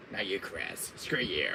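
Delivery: lightly voiced villain dismissal; a hint of contempt; Wesker. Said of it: "getting into my Wesker fever".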